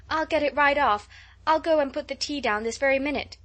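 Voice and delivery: hurried eager offer. Acted. Anxious girl rushing to make tea for her friend, eager. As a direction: rush to fix things, almost frantic.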